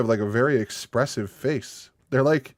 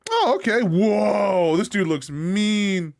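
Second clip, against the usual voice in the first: much higher, louder, surprised; high startled oh; surprised oh okay; 6 semitones higher, 3 dB louder, slower than usual. Real, not acted. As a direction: jump up in surprise. Seeing mean-looking dog.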